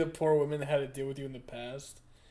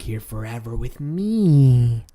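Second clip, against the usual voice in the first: slow, louder sinister voice; mock clingy menace; creepy girl; 3 semitones lower, 11 dB louder than usual. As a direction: draw it out creepily. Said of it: "That's what girls do to you".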